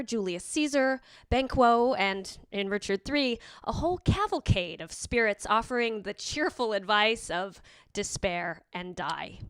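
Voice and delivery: ordinary talk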